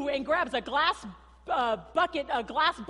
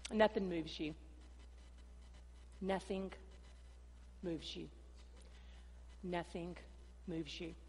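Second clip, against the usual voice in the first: hushed emphatic preaching; 5 semitones lower, 13 dB quieter than usual. Acted. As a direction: let each word land quietly. Preaching repeated line.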